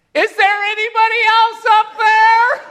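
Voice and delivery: very high, loud plea to anyone else; punchline, hiker yelling for someone besides God; desperate call. Acted. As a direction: shout up, hoping for another answer. Punchline, hiker calls again.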